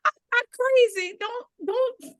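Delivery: high, frantic begging voice; imitating a panicked plea. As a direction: plead as if afraid of being taken away. Coworker pleads, panicked.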